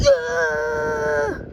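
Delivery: sharp high yell; high shout. Acted. Character thrown by the wind, yelling high. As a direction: yell as you're flung away.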